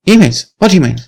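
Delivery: baffled denial; surprised Lucy. Acted.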